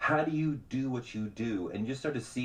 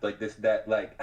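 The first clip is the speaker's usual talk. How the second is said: normal talking voice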